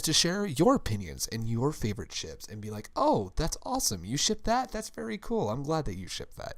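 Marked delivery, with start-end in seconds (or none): 3.0-6.6 bright, high mock approval of a fan's ship; sincerely approving. To a fan sharing their ship, high sincere approval. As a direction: gush with pitch up, honestly glad. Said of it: "in a non-sarcastic but respectable way".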